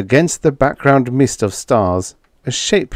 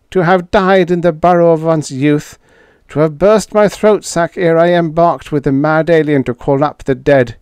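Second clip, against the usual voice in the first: higher alien voice, incredulous; alien Schla; 8 semitones higher, 4 dB louder than usual. A narrator's reading. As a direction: play the alien in disbelief. Schla laments in Grachian.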